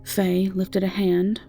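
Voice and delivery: ordinary talk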